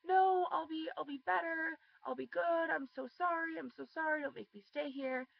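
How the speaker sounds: mimicking a ten-year-old begging her parents not to leave; imitating crying pleas. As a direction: beg like a scared little girl. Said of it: "She just kept crying".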